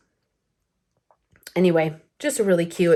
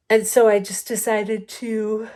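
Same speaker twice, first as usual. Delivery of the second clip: yawning; 3 semitones higher than usual. Real, not acted. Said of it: "Sorry, I'm yawning as I".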